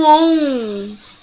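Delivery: ONS held long and loud through the nose; slow, loud nasal ONS; nasal sound demo. Shown as a demonstration. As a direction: stretch ONS into a nasal hum. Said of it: "Onde tá o som nasal?".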